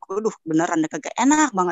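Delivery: ordinary talk, nothing marked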